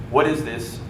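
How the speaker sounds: normal talking voice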